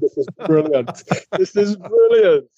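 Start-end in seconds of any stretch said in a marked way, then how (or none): 1.4-2.5 higher, drawn-out mock amazement; delighted exclamation. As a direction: stretch it out in acted delight. Retold delight, repeated with emphasis.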